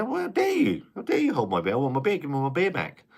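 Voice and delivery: hammed-up, high and pompous outrage; mock indignation. Retelling demanding his beer back, high mock outrage. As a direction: act the spoilt drinker. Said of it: "I said".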